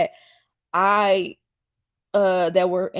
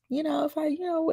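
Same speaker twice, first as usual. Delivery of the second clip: ordinary delivery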